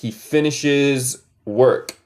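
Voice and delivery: "he finishes work" drawn out slowly; slow model sentence. Shown as a demonstration.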